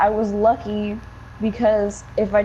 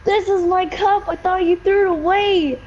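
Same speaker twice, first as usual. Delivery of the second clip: high, urgent retelling of panic; panicked realization; 8 semitones higher than usual. Retold or imitated. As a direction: blurt it out in alarm. Retold panic to mom.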